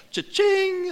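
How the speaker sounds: squealed high cha-ching; Cha-ching! in a high excited squeal. Shown as a demonstration. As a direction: shoot Cha-ching! way up like a slot machine payout. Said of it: "Everybody say that with me".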